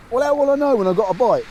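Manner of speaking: imitating a noisy mate, high and loud; high-pitched, loud retold question; loud-mouthed mate. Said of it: "he's very loud-mouthed and, and noisy".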